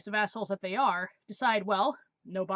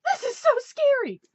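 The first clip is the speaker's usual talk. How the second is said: put-on much higher, louder squealy teen girl; 8 dB louder than usual. Girl in the theater turning to her, high squealy teen. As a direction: gush it like a frightened thirteen-year-old. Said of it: "literally turns to me and goes".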